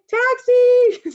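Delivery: piercing high shout for a taxi; all-out scream at the top of the voice. Retold or imitated. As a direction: yell to hail a cab from the phone booth.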